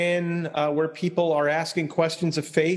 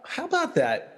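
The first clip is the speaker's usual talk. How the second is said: surprised; 12 semitones higher than usual. Retold or imitated. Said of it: "I thought".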